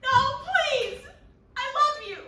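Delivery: high, loud desperate plea; high, loud mock plea of a lover; pleading cry. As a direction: beg him not to go, crying out. Dab begs Napoleon not to go.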